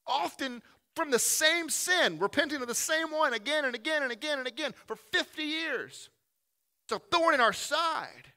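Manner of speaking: high, loud preacher's insistence; full-force preaching. Real, not acted. Preacher to congregation on repeated sin, impassioned preaching. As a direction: drive the repetition home, loud and high.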